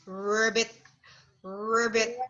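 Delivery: low, rolled frog ribbit; frog croak. Shown as a demonstration. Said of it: "Frog says".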